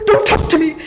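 high, loud mock sobbing; fake crying. Daughter brushing off her mother, loud fake crying. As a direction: wail it through put-on tears. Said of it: "I made myself cry".

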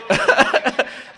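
breathy burst of laughter at his own joke; a real high cackle of laughter; loud high laugh. Real, not acted.

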